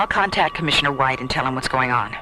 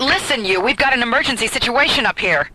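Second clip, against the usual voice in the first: louder, higher, insisting on an emergency; angry demand; 7 semitones higher, 4 dB louder than usual. Acted. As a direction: lean on the stubborn officer. Arguing with officer.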